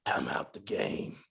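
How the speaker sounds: very low, slow, whispered growl; Batman-like Lulu. Acted. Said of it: "that motherfucker sound like Batman".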